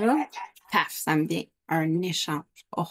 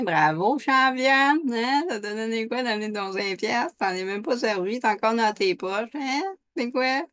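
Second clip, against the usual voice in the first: higher, mocking congratulations turned reproach; sarcastic scolding; 5 semitones higher than usual. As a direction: berate with sarcastic praise, pitched up. Inner voice mocks her with sarcasm.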